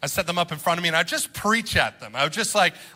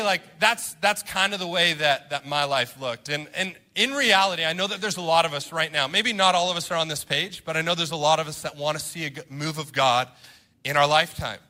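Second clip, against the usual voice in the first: ordinary talk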